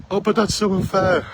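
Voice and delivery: high, mocking moan of a sulking applicant; sarcastic parody of a complaining recruit; mock whiny candidate. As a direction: whine it like a candidate who thinks it's unfair.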